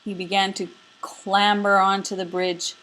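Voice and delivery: ordinary delivery, nothing marked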